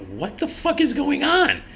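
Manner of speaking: high, drawn-out incredulous question; bewildered. Retold or imitated. Driver with hands on the car roof, high-pitched bewilderment. Baffled at being ordered out.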